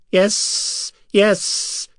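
high thin alien whisper; high creature voice. A narrator's reading. Another Silver Sand answering the others. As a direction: breathe it high and strange. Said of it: "answered another".

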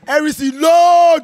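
high shout ending in a yell; calling out. Real, not acted. Fan calls on Harrison to load, shouting. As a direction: bellow the name like a fan chant.

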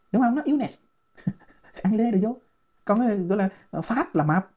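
usual speaking voice

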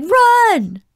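loud, very high yell; high, drawn-out mock-panic cry; retold urgent shout. A narrator's reading. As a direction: act out yelling at a friend to flee. Retelling his urgent shout to flee.

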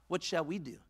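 normal talking voice

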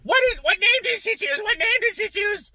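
high, loud mock yell; high-pitched pestering yell, retold; imitating yelling. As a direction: imitate someone yelling a question at you. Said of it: "Vicky's yelling at me going".

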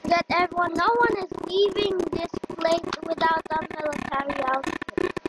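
usual speaking voice

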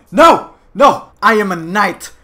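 loud, grand knightly vow; mock-heroic knight. Knight refusing to change sides, grand loud declamation.

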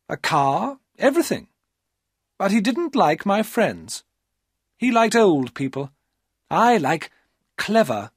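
put-on young woman charming Paul; high, wheedling female voice; Maria's coaxing voice.

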